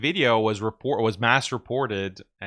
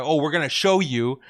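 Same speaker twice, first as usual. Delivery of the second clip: mock bossy; 3 semitones higher than usual. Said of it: "trying to be bossy".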